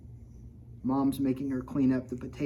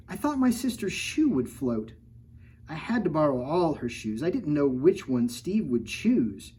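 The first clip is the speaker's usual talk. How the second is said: lively picture-book read-aloud; reading to children; 3 semitones higher than usual. A narrator's reading. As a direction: read the rhymes to a kid audience. Reads rhyming picture book.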